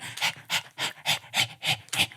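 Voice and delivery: quick voiceless puffs of breath; dog panting. Acted. Recalling the dog from last week's podcast, panting. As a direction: pant rhythmically, imitating a dog. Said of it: "he was talking about panting".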